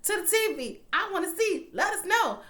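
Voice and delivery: high, eager begging at the TV; excited high demand; high pleading. Retold or imitated. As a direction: plead with the screen. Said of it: "I was like".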